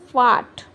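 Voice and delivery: ਫਾਰਟ with no R sound; silent R. Shown as a demonstration. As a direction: drop the R in ਫਾਰਟ, long open vowel into T. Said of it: "ਆਰ ਵਿੱਚ silent ਆ".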